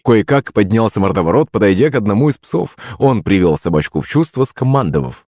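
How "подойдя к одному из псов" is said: ordinary delivery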